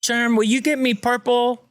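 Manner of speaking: put-on a calm kid asking for a crayon; dad's podcast, a touch of the daughter's voice; little girl asking. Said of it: "the most I'm gonna say is".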